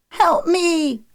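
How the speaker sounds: put-on high-pitched child's plea; screech. A narrator's reading. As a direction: screech for help like a scared boy. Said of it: "Milo screeched".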